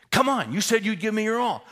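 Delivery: loud, high, demanding urging; yelling coach. Retold or imitated. Coach to tiring blindfolded player, yelling demandingly. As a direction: hold him to it at full volume.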